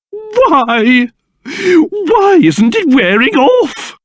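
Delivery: high, wailing dismay; anguished disbelief. Acted. King learns his curse remains, wailing in dismay. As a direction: lament it slowly and theatrically.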